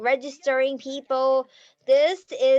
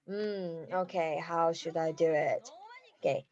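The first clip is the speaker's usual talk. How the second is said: lower, softer thinking aloud; musing to herself; 7 semitones lower, 7 dB quieter than usual. Retold or imitated. Summer Cha planning her vision, quiet musing. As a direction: ponder quietly, half to yourself. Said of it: "Thinks about".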